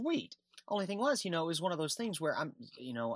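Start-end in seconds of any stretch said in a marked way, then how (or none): 0.0-0.3 high-pitched, drawn-out "sweet"; acting out a delighted little response; pleased reaction. Graduate recalling having a job waiting, pleased.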